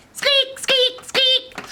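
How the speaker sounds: very high, loud mouse squeaks; high-pitched storybook mice. Acted. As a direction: squeak like a tiny alarmed mouse. Mice squeaking their warning.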